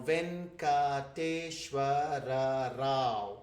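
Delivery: Venkateshwara Rao spelled out slowly, syllable by syllable; slow and deliberate. Shown as a demonstration. Teacher slowly shows a long Telugu name syllable by syllable. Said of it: "It's like six, seven syllables".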